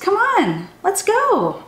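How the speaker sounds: normal talking voice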